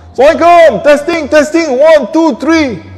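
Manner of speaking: high, loud announcing voice; loud, high-pitched sound check; projected mic test. Shown as a demonstration. Demonstrates a test recording.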